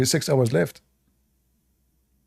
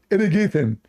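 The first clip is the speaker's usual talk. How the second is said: higher, proud punchline; mock Abdul; 5 semitones higher than usual. Abdul repeats his claim to the friend, higher proud. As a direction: announce it proudly.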